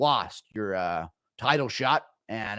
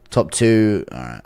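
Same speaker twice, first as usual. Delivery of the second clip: ordinary talk, nothing marked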